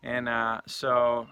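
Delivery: ordinary talk, nothing marked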